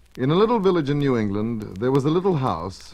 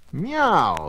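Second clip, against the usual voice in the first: a higher, lightly voiced happy cat meow; 6 semitones higher than usual. Wobble the cat meows happily on his comfy seat, a light meow. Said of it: "he cried happily".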